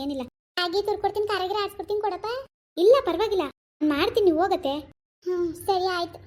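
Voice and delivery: ordinary delivery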